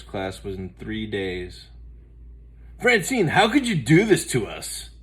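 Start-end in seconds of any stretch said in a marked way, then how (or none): 2.8-4.9 put-on high, loud reproachful kid; a boy's dismayed complaint. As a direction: blame her, heartbroken. Buster upset about team.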